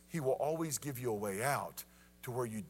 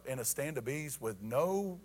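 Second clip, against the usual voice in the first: normal talking voice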